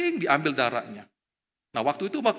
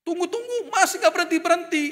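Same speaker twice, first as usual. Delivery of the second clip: annoyed and worried; 12 semitones higher, 4 dB louder than usual. Retold or imitated. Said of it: "kesel juga saya. Dan khawatir juga".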